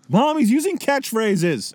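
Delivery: put-on tattling kid. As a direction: whine to mom like a little kid.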